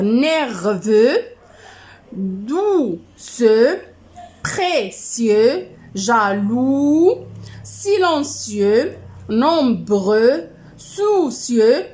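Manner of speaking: each word drawn out, syllable by syllable; slow dictation. Shown as a demonstration. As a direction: stretch the words for pupils writing them down. Teacher dictating adjectives word by word.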